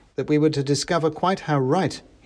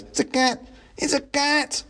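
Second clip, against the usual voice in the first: alarmed mouse cries. A narrator's reading. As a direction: pass the alarm on as a crowd of mice. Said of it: "the cry went up all around".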